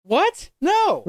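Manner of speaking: high-pitched, startled disbelief; incredulous outburst. Real, not acted. Reacting to friends about prison for life, incredulous. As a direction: blurt it out, shocked and high.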